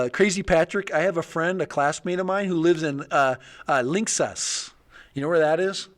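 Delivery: ordinary delivery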